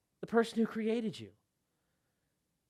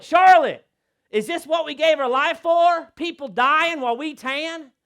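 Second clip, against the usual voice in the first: loud, high, pleading outcry; anguished plea; 9 semitones higher, 10 dB louder than usual. Retold or imitated. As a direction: cry out in disbelief. Anguished cry to wife.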